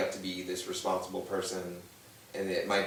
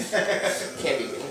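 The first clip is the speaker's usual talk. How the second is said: higher, slower laughing repeat; laughing disbelief; 8 semitones higher, slower than usual. Retold or imitated. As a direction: stretch it out through a laugh.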